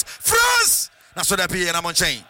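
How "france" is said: sudden high yell of the team name; commentator's sudden high cry; excited shout. Real, not acted. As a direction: shout it as the danger comes. Commentator yells at danger.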